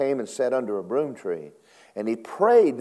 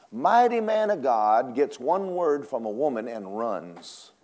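ordinary talk

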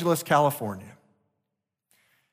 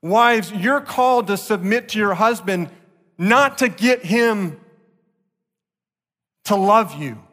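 louder, higher exhortation; forceful preaching; 5 semitones higher, 5 dB louder than usual. Real, not acted. Pastor urging wives to submit, forceful preaching.

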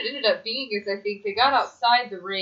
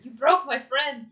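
mock Rusev accent; 6 semitones higher than usual. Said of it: "Rusev very upset".

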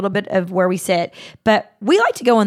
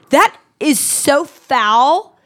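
slow, high, loud disgust; appalled and grossed out; 6 semitones higher, 5 dB louder, much slower than usual. Retold or imitated. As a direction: drag out the disgust. Disgusted retort to the man.

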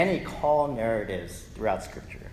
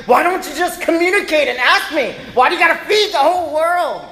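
high, loud angry retort; mock furious yell; shouting mom; 13 semitones higher, 9 dB louder, faster than usual. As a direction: fire back at the husband.

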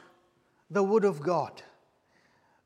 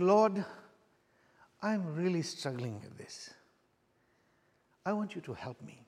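soft pleading prayer; 2 semitones lower, 6 dB quieter than usual. Retold or imitated. Retold prayer at the altar.

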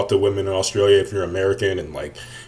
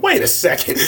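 a much higher, startled wait a second; surprised double take. Retold or imitated. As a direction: catch yourself, suddenly confused. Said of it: "I'm like".

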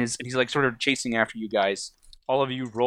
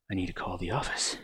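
low, hushed, halting mutter; muttering to himself; 4 semitones lower, 9 dB quieter than usual. Acted. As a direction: mutter in baffled fragments. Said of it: "He's like".

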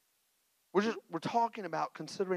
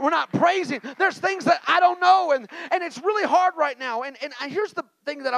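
raised preaching; 7 semitones higher, 10 dB louder than usual. Acted. Preaching on praise.

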